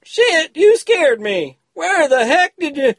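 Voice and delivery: high, startled yelp of fright. Acted. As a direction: jump out of your skin and blurt it. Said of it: "Wardell jumped a foot".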